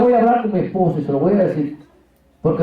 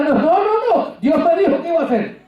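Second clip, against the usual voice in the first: much higher, eager no no no; insistent; 9 semitones higher than usual. Retold or imitated. Wife insisting he accept, much higher. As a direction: cut her off, insisting. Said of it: "Yo tratando de calmar".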